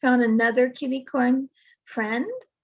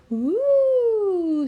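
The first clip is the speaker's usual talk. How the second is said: impressed; 8 semitones higher than usual. Acted. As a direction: gasp it out in awe, much higher.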